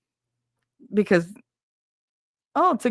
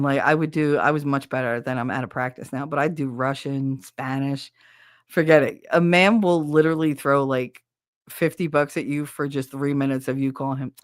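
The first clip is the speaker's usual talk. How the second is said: normal talking voice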